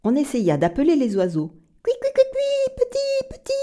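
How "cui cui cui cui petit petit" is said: high chirping bird call. Acted. Calling birds in story. (appel d'oiseau aigu)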